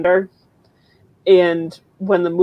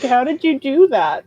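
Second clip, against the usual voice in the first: higher-pitched, acted astonishment; amazed mother; 7 semitones higher than usual. Poor mom asking how she did it, acted amazement. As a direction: ask it high, in mock awe.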